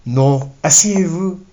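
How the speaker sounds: lightly voiced young Parisian woman in French; Parisian French accent. Young woman offers stranger a seat, Parisian French accent. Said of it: "she replied with a Parisian accent".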